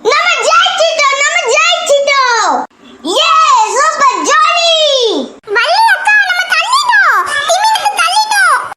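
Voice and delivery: high, happy cheering; excited victory cheer. Pretend. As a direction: celebrate the win at the top of the voice. Kids celebrate saving the whale.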